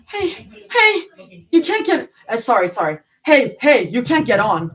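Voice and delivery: high, loud breaking cry; crying distress. A narrator's reading. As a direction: protest in panic. Zoe stopped, voice breaking.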